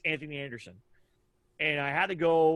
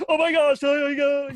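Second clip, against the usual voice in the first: much higher and louder, gushing squeal; starstruck fan squeal; 11 semitones higher, 9 dB louder than usual. Shown as a demonstration. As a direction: show the overexcited fan you shouldn't be. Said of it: "You can't be like".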